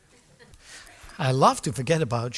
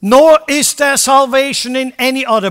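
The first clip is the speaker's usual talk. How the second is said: Peter's bold proclamation; 9 semitones higher, 12 dB louder than usual. Acted. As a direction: declare it boldly to the whole crowd. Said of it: "this incredible saying".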